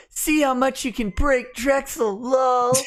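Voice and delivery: high-pitched put-on character voice; Mister Y voice. Reading a chat dare to break a co-host, high character voice. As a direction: read it out in a high silly voice. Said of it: "in Mister Y's voice".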